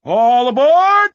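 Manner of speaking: drawn out slowly, a big booming shout; loud rallying call. Acted. Welcoming newcomers to the Ark with a long, loud, slow call. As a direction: call everyone on board like a conductor.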